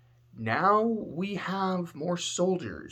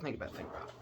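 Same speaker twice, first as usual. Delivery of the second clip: much softer whispered think about it; whispered urging; 14 dB quieter, much faster than usual. Retold or imitated. War faction tempting the others, whispered urging. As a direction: lean in and whisper it conspiratorially.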